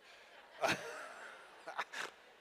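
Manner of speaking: breathy burst of real laughter; long unvoiced laugh. Real, not acted. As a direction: laugh at your own joke. Laughs at own bold rebuke.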